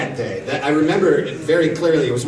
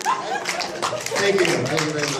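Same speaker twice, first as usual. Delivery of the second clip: [name] impression; 5 dB quieter, slower than usual. Crowd asks for [name], so he thanks them in that voice. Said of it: "[name]".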